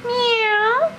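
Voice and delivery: high, rising, stretched meow; squeaky-high questioning meow; cat meow imitation. Shown as a demonstration. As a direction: mimic a kitty for a toddler. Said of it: "What's a cat say?".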